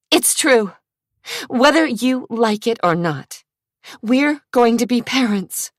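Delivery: angry. A narrator's reading. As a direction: fling it at him in anger. Trisha insisting, upset.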